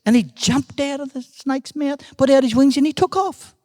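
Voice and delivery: high, lifted storytelling; excited story narration. A narrator's reading. Uncle tells of the bird's escape, excited. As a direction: let the voice take flight with the bird.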